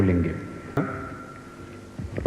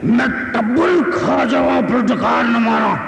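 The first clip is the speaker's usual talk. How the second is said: higher, slow booming film-hero dialogue; Sultan Rahi roar; 7 semitones higher, slower than usual. Retold or imitated. Imitating Sultan Rahi delivering the famous dialogue. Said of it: "कहा है सुल्तान राही ने कुछ इस तरह से".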